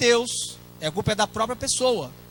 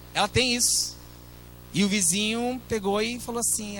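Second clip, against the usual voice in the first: ordinary talk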